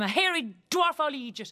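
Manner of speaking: mock yell, thrown out as name-calling; retold shouting. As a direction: shout it in the retelling, not full force. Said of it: "I'm shouting at him".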